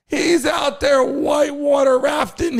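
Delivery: excited. Real, not acted. Host marvels at [name] rafting.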